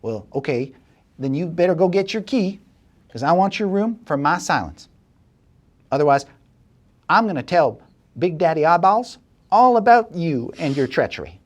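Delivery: the schemer warns she'll tell Big Daddy Eyeballs, high and bossy; threatening schemer. Acted. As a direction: push him with the threat. Same woman threatens.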